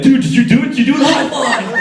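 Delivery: high, loud, hammed-up eagerness; excited schoolboy. Schoolboy asking classmates next morning, high excited mock voice. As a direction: pester your buddies with mock excitement.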